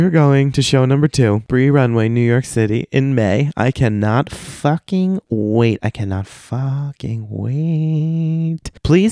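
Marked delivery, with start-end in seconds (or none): 3.6-5.8 slow, drawn-out excitement; emphatic anticipation. Real, not acted. Excited sign-off about the show. 5.8-8.7 very slow, higher and softer; exaggerated slow-motion longing; drawn-out yearning. Real, not acted. Repeats it even more drawn out.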